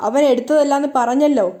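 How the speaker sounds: ordinary delivery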